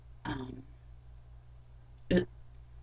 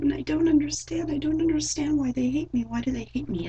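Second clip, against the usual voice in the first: much higher pleading wail; wailing spirit; 10 semitones higher than usual. Retold or imitated. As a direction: cry it out like a grieving spirit. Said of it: "it was crying".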